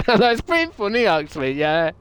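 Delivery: giggling high voice; laughing. Real, not acted. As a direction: laugh out the praise. Laughing at chat joke.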